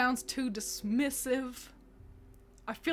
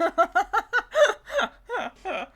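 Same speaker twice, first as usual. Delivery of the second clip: burst of laughing; 9 semitones higher, 7 dB louder than usual. Real, not acted. Laughs at chat.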